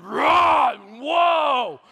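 loud, high imitation lion roar; lion's roar. Preacher imitating the safari lion, intense roar. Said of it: "he roared".

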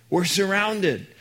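high, alarmed cry; panicked servant. Acted. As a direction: gasp it out as if trapped. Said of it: "He's panicked".

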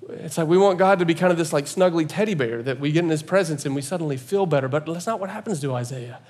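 ordinary talk, nothing marked

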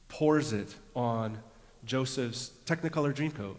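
ordinary talk, nothing marked

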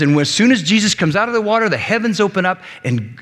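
normal talking voice

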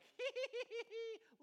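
high mock cackle of glee; announcers' gleeful laughing, imitated.

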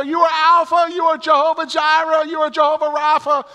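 high, loud hammed-up proclamations; high, loud mock praise; barking church lady. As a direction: shout the names of God like a fervent church lady. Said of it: "she starts barking out".

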